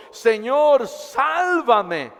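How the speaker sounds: high, loud cry for rescue. Acted. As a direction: shout for help as he sinks. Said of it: "dio voces diciendo". (grito de auxilio)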